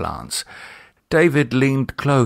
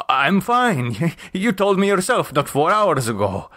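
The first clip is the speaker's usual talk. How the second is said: nervous laughing denial; 7 semitones higher than usual. Acted. Jose nervously denies bad news.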